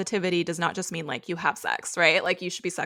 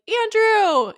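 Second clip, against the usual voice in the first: calling out; 14 semitones higher, 5 dB louder than usual. Retold or imitated. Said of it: "gets to the lobby and is like".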